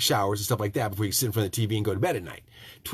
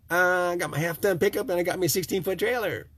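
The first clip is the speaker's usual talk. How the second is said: naive customer; 8 semitones higher than usual. Pretend. Imagined naive customer answering.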